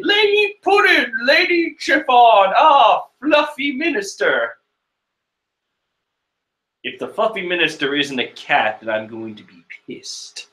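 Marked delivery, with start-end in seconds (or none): 0.0-4.6 high, put-on townsperson greeting; townsfolk voice. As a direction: call out like an awed villager. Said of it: "people are noticing them as they're saying".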